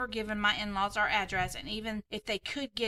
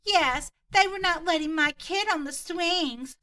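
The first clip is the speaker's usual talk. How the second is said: higher, louder, slow mock Karen voice; hammed-up entitled mom, slow and high; 8 semitones higher, 6 dB louder, slower than usual. Entitled mom confronting a seven-year-old, in a higher, louder, slow Karen voice.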